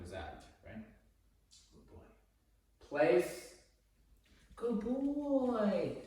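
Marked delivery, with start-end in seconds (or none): 1.7-6.0 loud command, then high bright praise. Real, not acted. Trainer commands the dog to place, then praises him high.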